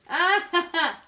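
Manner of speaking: high, loud "ah-ha-ha"; sudden loud cackling; loud laugh. Real, not acted. Bursts out after the film clip.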